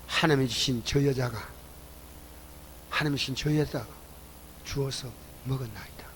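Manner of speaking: low, hushed excuse, fading away; subdued excuse. Acted. Adam answering God about the woman, subdued. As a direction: voice Adam softly, shifting the blame.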